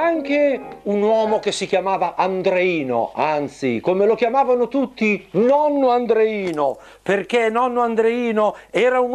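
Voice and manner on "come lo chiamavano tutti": ordinary delivery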